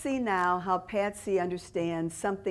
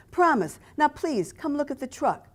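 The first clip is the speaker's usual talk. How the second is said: put-on higher pleading Italian-accented seller; 7 semitones higher than usual. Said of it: "He spoke with a thick Italian accent".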